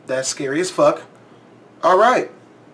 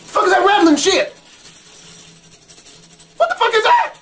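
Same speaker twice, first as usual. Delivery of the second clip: from a tense question up to a much higher cry; more and more frightened, ending much higher; rising panic; 4 dB louder, faster than usual. Real, not acted. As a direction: panic building as the noise grows. Creepy rattling sound builds tension.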